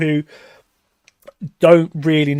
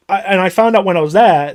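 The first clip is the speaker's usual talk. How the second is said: normal talking voice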